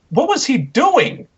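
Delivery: high, incredulous what-was-that; baffled disbelief. Real, not acted. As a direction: sound utterly baffled by what you saw. Baffled rant about the promo.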